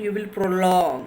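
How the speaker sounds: prolong stretched out long on its last syllable; prolonged word. Shown as a demonstration. Teacher explaining longer duration, prolong drawn out. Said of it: "Longer in duration means".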